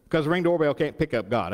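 ordinary delivery

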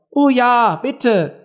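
high, eager group plea; chorus plea. A narrator's reading. The other animals echo Alice's request, pleading. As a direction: chime in high and keen. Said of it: "die anderen wiederholten im Chor".

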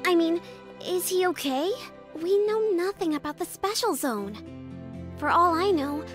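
high-pitched anxious fretting; worried. Acted. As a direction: fret over a missing friend. Worrying about a lost friend.

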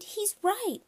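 put-on high, alarmed girl's voice; high-pitched cry without full volume; screaming. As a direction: wail it high in dismay. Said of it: "Shyla screamed".

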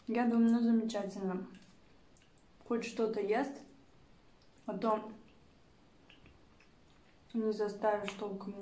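talking with food in mouth; speaking between bites; chewing while talking. Real, not acted. Seen 3: Bite taken at -2s; mouth moving over food while speaking.

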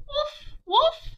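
put-on bouncy high puppy barks for kids. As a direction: bark like a little puppy. Dog barks in picture book.

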